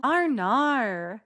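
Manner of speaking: exaggerated Australian "Arnar" for "oh no"; Australian accent. Shown as a demonstration. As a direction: ham up a drawn-out Australian accent. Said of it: "That's my Australian accent".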